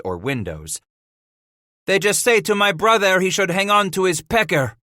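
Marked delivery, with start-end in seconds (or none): 1.9-4.8 high, angry snarl. A narrator's reading. Paul reports the enemy's taunt to his party, snarling. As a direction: snarl it out in outrage. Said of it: "Paul snarled".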